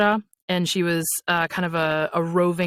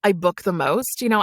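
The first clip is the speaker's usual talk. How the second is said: ordinary delivery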